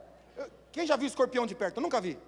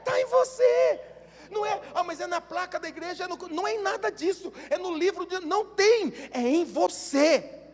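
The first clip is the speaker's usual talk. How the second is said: higher and louder preaching that peaks in a shout; fiery preaching; 7 semitones higher, 14 dB louder than usual. Acted. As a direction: rouse the congregation to amen. Preaches at full force.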